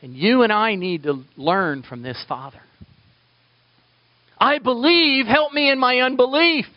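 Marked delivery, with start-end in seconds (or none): 4.4-6.7 much higher, louder anguished plea; pleading cry. Acted. Preacher voicing the father begging Jesus, pleading.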